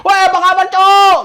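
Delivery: loud high call across open ground; shouting across. Retold or imitated. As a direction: yell a greeting from far away. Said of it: "bisa teriak-teriakan". (teriak memanggil dari jauh)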